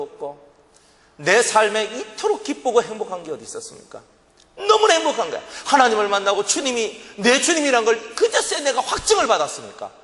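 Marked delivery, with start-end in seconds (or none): none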